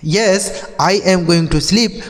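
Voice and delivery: slow, careful English question; slow model reading. Shown as a demonstration. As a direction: read it out slowly for learners. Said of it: "slow model answer repeated".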